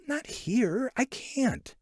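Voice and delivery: put-on a young girl shrinking back; young girl's voice. A narrator's reading. Girl refusing the boy.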